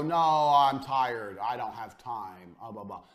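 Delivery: slow, droning mock of a lazy friend, trailing off; lazy excuse voice. Mocking lazy friends who won't work, slow whiny drone. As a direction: drag the words out like you can't be bothered.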